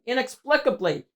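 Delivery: ordinary delivery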